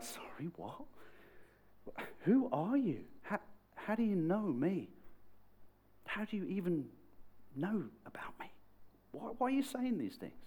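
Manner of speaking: high, halting incredulous questions; baffled. Retold or imitated. Stunned Nate questioning a stranger who knows him, baffled. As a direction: stumble over it in mock disbelief.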